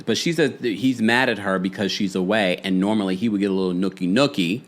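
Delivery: normal talking voice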